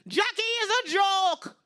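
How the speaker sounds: put-on high, slow Jamaican-accented put-down; Jamaican mother. Mom cutting in before the neighbors, high slow Jamaican accent. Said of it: "My mom jumped in".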